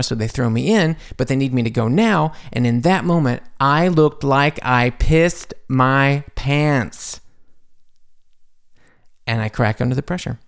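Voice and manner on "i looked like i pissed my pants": very slow, each word held; emphatic drawn-out punchline. Real, not acted. Extra reliving the moment on set, drawn-out emphasis. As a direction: spell out the embarrassment one word at a time.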